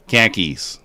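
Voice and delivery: "Khakis" said as Boston "car keys"; a light Boston accent on "Khakis". Retold or imitated. Explaining Boston speech to chat, light Boston accent. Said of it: "But in Boston".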